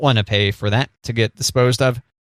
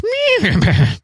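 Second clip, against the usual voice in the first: high loud horse neigh; horse whinny; 3 dB louder than usual. Pretend. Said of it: "You like my horse impression?".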